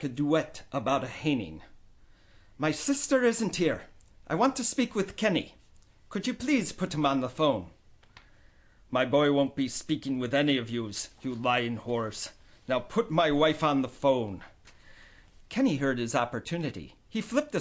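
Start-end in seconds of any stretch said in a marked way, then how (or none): 2.6-7.7 higher, light Irish lilt; prim lilting Irish aunt. Acted. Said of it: "Aunt Maureen's light lilt". 8.9-14.4 angry father. Acted.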